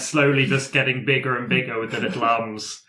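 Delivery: ordinary talk, nothing marked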